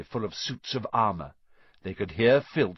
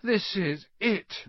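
moaning; 10 semitones higher, slower than usual. A narrator's reading. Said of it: "Ron moaned".

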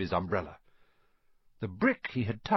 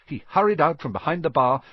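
plain reading voice

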